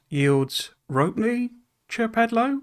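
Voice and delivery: ordinary talk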